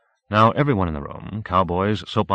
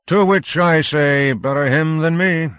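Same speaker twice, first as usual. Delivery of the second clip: put-on slow, louder drunken toast; mock toast; 9 semitones higher, 7 dB louder than usual. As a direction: raise a glass with a sneer.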